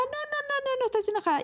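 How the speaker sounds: high, insistent no-no-no that protests too much; hasty denial. Retold or imitated. Partner asks if she's angry, she denies it in a high mock protest.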